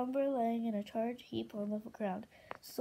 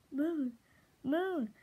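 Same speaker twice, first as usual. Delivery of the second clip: raised, falling cry of a name; urgent calling; 4 semitones higher, 5 dB louder than usual. Acted. Darkstalker calls to Moon in her vision, urgent calling. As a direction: call her name, higher and louder.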